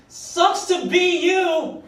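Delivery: high, slow taunting jeer; sing-song it like a passing heckler; high drawn-out mock taunt from a passing car; mocking heckler yell. Heckler yelled at him.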